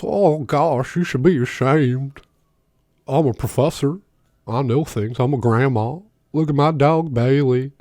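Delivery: Liz Warren impression. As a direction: lecture like a prim, self-satisfied professor. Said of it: "I'm giving her that accent".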